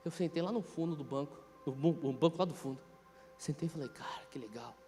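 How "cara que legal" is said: much softer and lower, almost whispered; hushed wonder at stained glass; hushed awe. Retold or imitated. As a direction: marvel under your breath in church. Awed whisper inside the cathedral.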